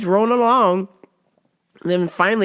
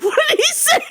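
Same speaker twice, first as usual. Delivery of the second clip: very high mock mom voice breaking into laughter; hammed-up squeal of a question; imitating frantic mom; faster than usual. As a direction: play a frantic mother while cracking up. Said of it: "And then the mom's like".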